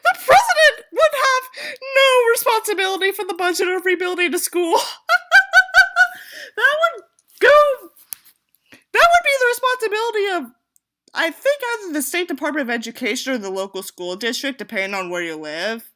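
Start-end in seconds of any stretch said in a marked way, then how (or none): 0.0-10.5 incredulous. Real, not acted. Mocking own story's logic.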